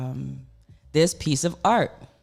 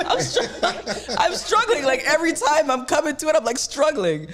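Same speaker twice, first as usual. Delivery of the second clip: laughing; 12 semitones higher than usual. Real, not acted. Host laughing about guest's name.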